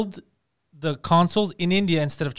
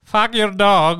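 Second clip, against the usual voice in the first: imitating an Indian accent, a bit louder; mock Indian accent; 3 semitones higher, 5 dB louder, much slower than usual. Teasing a friend's singing, mock Indian accent. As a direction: parrot his line in a mock Indian accent. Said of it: "you sound like an Indian scammer".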